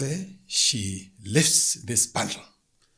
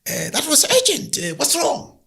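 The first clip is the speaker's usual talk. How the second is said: startled ancestral spirit; 6 dB louder than usual. Acted. Ancestral spirit answers urgent call.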